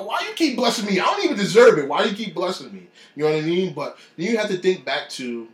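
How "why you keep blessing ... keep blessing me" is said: high, loud grateful disbelief; overwhelmed thanks. Retold or imitated. Thanking God for the blessings, overwhelmed and loud. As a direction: cry out in thankful wonder.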